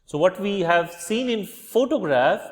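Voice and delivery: ordinary talk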